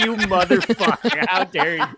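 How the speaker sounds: hammed-up indignation, close to laughing; mock angry. Friend scolding over a banana split, mock anger. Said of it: "I was angrily eating a banana split".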